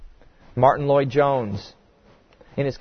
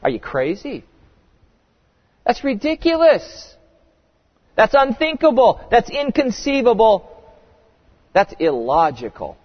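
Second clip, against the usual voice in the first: higher, incredulous rejections; indignant preacher's dismissal; emphatic outrage; 5 semitones higher than usual. Acted. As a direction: scoff at the very idea. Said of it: "insert other emphatic words".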